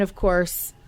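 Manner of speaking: ordinary talk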